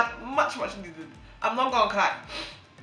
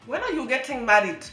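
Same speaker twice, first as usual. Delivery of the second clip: normal talking voice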